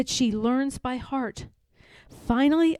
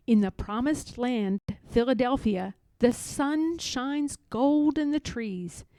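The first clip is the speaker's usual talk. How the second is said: plain reading voice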